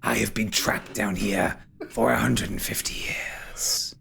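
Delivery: whispery, brooding spirit voice; ghostly villain. Acted. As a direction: tell the grim history in a menacing half-whisper. Game master voices Derethor.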